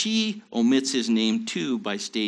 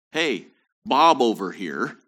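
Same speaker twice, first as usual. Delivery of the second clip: mock casual call; 6 dB louder than usual.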